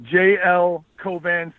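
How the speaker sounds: usual speaking voice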